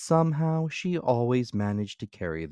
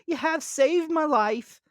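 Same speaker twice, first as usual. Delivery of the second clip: high, loud cartoon grasshopper; bright piping insect voice; 6 dB louder than usual. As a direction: squeak it out as the carefree grasshopper. Grasshopper character voice.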